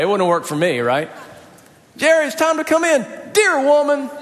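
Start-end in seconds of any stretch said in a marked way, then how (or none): 2.0-3.0 put-on hollering for her boy from the porch; imitated mama calling Jerry in for the night; mom calling kid in. Mom calling son Jerry home. 3.3-4.1 put-on high cheeky little-boy voice with a laugh; sassy kid. Kid sassing mom.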